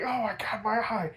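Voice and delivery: high mock Joker yelp; Joker crying out. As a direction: squeal it like a hurt cartoon villain. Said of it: "And Joker's like".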